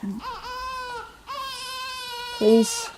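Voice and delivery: high, whiny despair; exasperated wail. Acted. Passenger despairing as the baby cries again.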